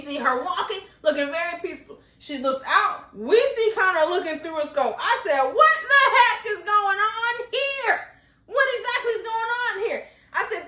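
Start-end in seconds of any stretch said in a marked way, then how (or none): 5.4-10.1 high, loud half-laughing wail; laugh-crying in disbelief; distressed laughing cry. Real, not acted. Dreading the sniper scene.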